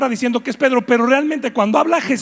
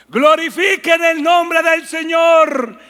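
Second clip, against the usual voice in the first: excited shouting; 9 semitones higher, 5 dB louder than usual. Acted. Fish answer the Lord's call.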